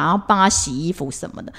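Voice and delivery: usual speaking voice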